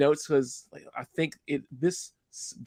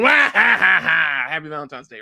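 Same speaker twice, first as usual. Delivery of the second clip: Waluigi voice; 7 dB louder than usual. Retold or imitated. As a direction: cackle it like a cartoon baddie. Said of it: "or Waluigi going".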